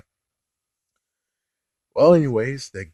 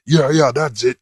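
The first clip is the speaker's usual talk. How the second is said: mock eager agreement; 4 semitones higher, 6 dB louder than usual. Mock eager reply in sketch.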